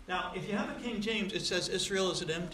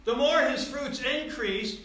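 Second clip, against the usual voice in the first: preaching at full force; 6 semitones higher, 8 dB louder than usual. Acted. Preacher denouncing a showy culture without Christ. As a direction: thunder it from the pulpit.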